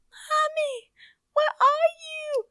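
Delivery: very high, plaintive little voice; toddler impression. Mom imitates toddler calling after being left, high wail. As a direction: call out like a lost toddler, drawn out. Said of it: "she's like".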